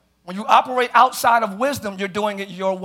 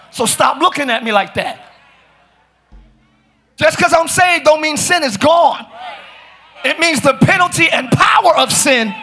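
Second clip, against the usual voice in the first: raised, projected sermon voice; fired-up preaching; 5 semitones higher, 5 dB louder than usual. Real, not acted. Preacher telling the saved about sin's power, fired-up preaching. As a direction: build toward a shouted declaration.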